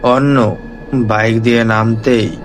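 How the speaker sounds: ordinary delivery, nothing marked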